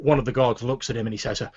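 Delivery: usual speaking voice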